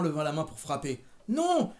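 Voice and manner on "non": very high-pitched no; defiant cry. A narrator's reading. Daughter stopping mother's raised hand, high sharp cry. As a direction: cry out to stop the blow.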